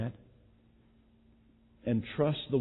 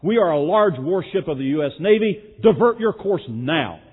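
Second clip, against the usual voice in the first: captain losing patience, loud and raised; angry snapping; 7 semitones higher, 11 dB louder than usual. Retold or imitated. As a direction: snap it back with fury, slowing on the order. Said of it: "snapped back with obvious anger and frustration".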